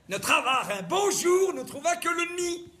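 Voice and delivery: hammed-up La Fontaine recital; mock dramatic recital. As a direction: declaim the fable like a ham actor.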